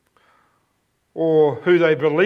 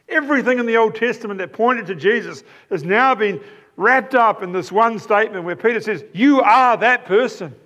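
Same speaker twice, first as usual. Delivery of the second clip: higher-pitched, building sermon voice; fervent preaching; 5 semitones higher than usual. Acted. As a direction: preach it with rising wonder. Rising preaching passage.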